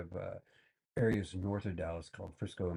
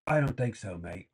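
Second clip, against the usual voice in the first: put-on loud, dismissive British accent on "I don't think so"; mocking the British cop, dismissive British accent; 5 dB louder than usual. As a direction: brush him off with a British accent, then drop to "mate". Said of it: "the freaking cop goes".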